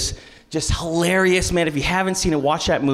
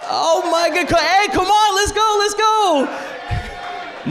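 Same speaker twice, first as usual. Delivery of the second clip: excited shout; 13 semitones higher, much slower than usual. Real, not acted. Pastor hyping up the kids in church, with a high, excited shout.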